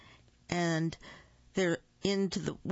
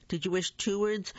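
higher, half-yelled question; mild yell; 4 semitones higher than usual. A narrator's reading. Parents keep questioning Olivia, a mild yell. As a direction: press the question with a light yell. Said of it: "ran in and yelled".

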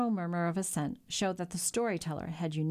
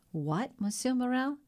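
higher, mildly choked disbelief; strangled voice; 6 semitones higher than usual. A narrator's reading. Stunned sailor to shipowner, in a mildly strangled voice. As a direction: ask it as if lightly strangled by shock, pitch rising. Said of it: "in a strangled voice".

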